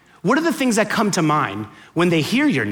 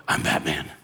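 very low, hushed superhero growl; Batman voice; 8 dB quieter than usual. Acted. Customer giving his coffee order name, Batman impression. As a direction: drop it far down low and quiet, like a masked hero. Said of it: "And I go".

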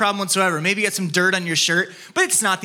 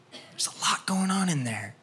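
whisper; 8 dB quieter than usual. Real, not acted. Intimate sermon point.

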